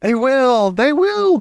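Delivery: high, delighted witch cry; thrilled witch chorus; delighted witches. Acted. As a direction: shriek with delight. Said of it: "cried the audience".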